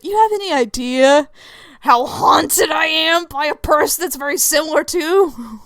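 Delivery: strained, hammed-up indignation; glaring outrage. Player glaring at Lucifer, emotional outrage. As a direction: tell him off, emotionally wound up. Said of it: "just glaring at him".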